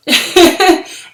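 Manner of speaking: loud laugh through real tears; teary laugh. Real, not acted. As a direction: laugh off the urge to cry. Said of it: "am I gonna cry".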